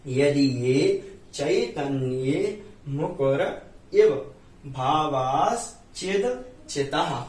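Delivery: each syllable drawn out slowly for the class; slow recitation. Shown as a demonstration. Teacher slowly reciting the verse for students. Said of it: "पहले एक एक शब्द का हम आपको उच्चारण करके बताते हैं".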